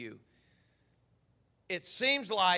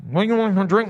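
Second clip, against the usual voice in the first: a loud, raised call across the counter; girl at the counter calls out what he wants to drink; loud server voice; 4 semitones higher, 15 dB louder, faster than usual. Retold or imitated. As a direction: shout the question over the noise. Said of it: "she's like".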